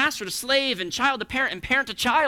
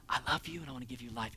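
much lower, much softer tender reply; voice of God; 8 semitones lower, 14 dB quieter, faster than usual. Acted. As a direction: answer like a gentle father. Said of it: "God replies".

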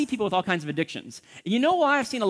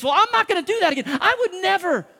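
high, loud overconfident promise; confident assertion; 12 semitones higher, 6 dB louder than usual. Retold or imitated. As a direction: brush it off with bold certainty. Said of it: "They start asserting these confident statements".

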